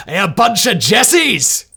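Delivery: loud, slow shout in a bad Scottish accent; mock Scottish soldier jeering in a bad Scottish accent; terrible Scottish accent. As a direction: bellow it in a hammed-up Scottish accent. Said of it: "Terrible Scottish accent".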